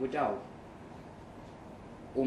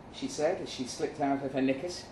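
ordinary delivery, nothing marked

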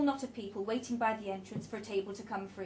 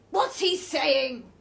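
loud, slow question; raised, impatient voice; irritated demand; 3 semitones higher, 10 dB louder, much slower than usual. A narrator's reading. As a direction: demand it loudly, stretching the words. Roger irritated by the boy.

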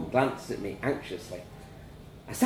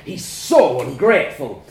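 angry father; 11 dB louder than usual. A narrator's reading.

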